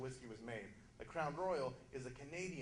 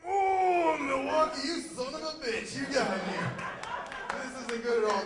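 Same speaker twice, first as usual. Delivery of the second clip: hammed-up sob, much higher and louder; mock crying; 9 semitones higher, 11 dB louder than usual. First-timer tasting Milwaukee's Best, wailing in mock betrayal. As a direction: wail in betrayed mock anguish.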